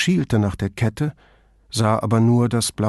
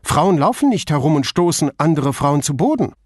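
louder, higher indignant retort; gruff, forceful objection; brusque; 5 semitones higher, 5 dB louder, faster than usual. A narrator's reading. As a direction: hammer it out indignantly. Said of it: "sagte sie schroff".

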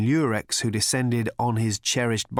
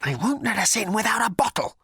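high, urgent hiss from Ford; whisper-hiss it in a high panic; panicked hiss; 11 semitones higher than usual. A narrator's reading. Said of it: "he hissed".

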